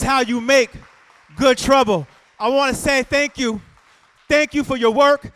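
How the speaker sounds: loud, high, impassioned oratory; rousing rally close. Acted. Speaker thanking activists for their work, rousing rally close. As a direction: ring it out to the crowd, then ease off toward the blessing.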